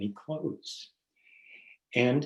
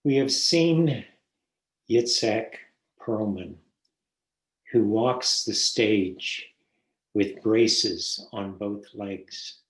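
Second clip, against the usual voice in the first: poem reading; 4 dB louder than usual. Acted. Reads a poem aloud.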